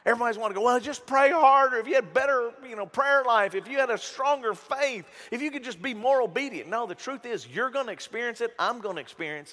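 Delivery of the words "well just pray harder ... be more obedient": louder, higher mocking preachy tone; mock know-it-all advice. Preacher mocking church folk who say pray harder. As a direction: parody the pious advice-givers, loud and high. Mocking what everybody says.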